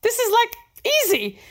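playful high-pitched God, amused and incredulous; hammed-up divine "are you kidding" voice; imitating God. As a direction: scoff fondly as a heavenly father, pitch leaping up. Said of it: "as if he, he was telling me".

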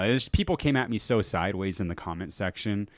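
ordinary talk, nothing marked

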